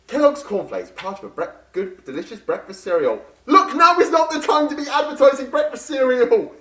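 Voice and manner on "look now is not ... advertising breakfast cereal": exasperated shout. Acted. Scolding partner mid-chase.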